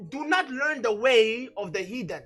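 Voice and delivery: ordinary delivery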